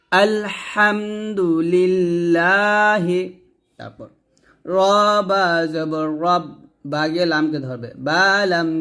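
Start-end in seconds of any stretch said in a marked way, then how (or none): 0.1-3.2 slow chanted tajweed reading of আলহামদুলিল্লাহি; teacher's model recitation; slow Quran recitation. Shown as a demonstration. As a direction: recite it slowly and melodically, every letter clear. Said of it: "teacher models recitation".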